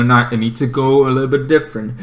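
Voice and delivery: usual speaking voice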